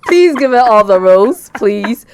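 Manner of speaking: loud, high laughing plea. Real, not acted. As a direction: shout it through laughter. Host joins laughing plea.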